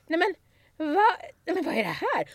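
high, dismayed exclaiming; shocked. Retold or imitated. Colleague finding empty wine bottles, high dismayed exclaiming. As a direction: gasp at the bottles.

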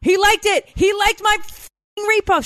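high-pitched excited yelling to her daughter; excited squeal. Retold or imitated. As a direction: shriek it like a starstruck fan.

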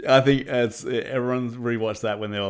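normal talking voice

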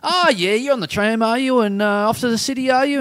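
high, over-familiar questioner; lucid weirdo. Retold or imitated. Weirdo quizzing a stranger on the tram, high over-familiar voice. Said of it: "lucid weirdo, which is one of my favourite types".

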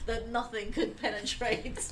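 slow, loud laugh-through words. Real, not acted. Laughing at own joke.

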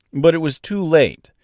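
ordinary delivery

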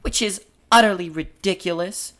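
high-pitched, self-important stage voice; piping character, airily resigned; Marie's character voice. Acted. Reads Marie's part in script.

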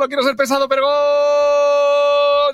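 long goal shout. Shown as a demonstration. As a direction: hold the goal cry like a radio commentator. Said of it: "lanzar, por ejemplo, un". (grito de gol de locutor de radio)

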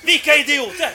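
loud, high indignant shout; mock outrage. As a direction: act shocked and appalled at the thieves. Retold outraged reaction, feigning ignorance.

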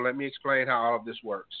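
ordinary talk

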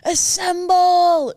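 high, loud shout with a long drawn-out "assemble"; big movie-quote battle cry, last word stretched; Anchorman rallying call. Acted. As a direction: holler it like summoning the troops. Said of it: "on Anchorman where he goes".

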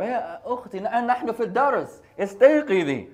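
high, loud teacher's wake-up call; teacher waking her. Retold or imitated. Teacher waking a sleeping student in class, high and loud. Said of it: "Our mu'allima has to wake her up".

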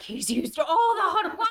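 high, half-laughing mock outrage at the husband; seeing red. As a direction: play up the fury while laughing at yourself. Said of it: "like, seeing red".

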